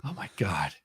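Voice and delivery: breathy whispered oh my God. Real, not acted. Host reacting to a joke, hushed whisper. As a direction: whisper it in disbelief.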